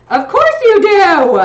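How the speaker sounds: high, loud, slow teasing voice; playful mock voice. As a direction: tease it in a put-on voice.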